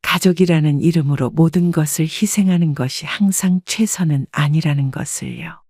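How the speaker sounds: ordinary delivery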